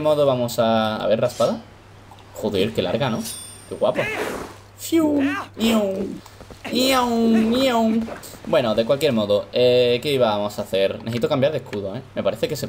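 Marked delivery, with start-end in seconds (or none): 4.8-8.0 high whistled call; whistle call. Real, not acted.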